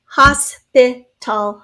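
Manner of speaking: Hospital very slowly, as HOS, PI, TAL; slow syllable-by-syllable demo. Shown as a demonstration. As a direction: say Hospital in three separate beats. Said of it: "Three syllables there".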